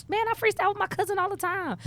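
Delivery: high, bragging voice; boastful younger self. Retold or imitated. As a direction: show off to the new friends. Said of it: "I was like".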